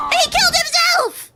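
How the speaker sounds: high, loud cry of disbelief; astonished shout. Real, not acted. Gamer seeing an enemy kill himself, astonished shout. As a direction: shriek it out in amazement.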